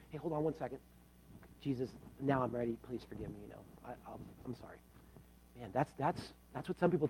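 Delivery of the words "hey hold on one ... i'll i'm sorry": much softer, weak last-breath voice; faint dying plea. Acted. Dying man on his last oxygen asking forgiveness, faint and weak. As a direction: fade out like a man on his deathbed.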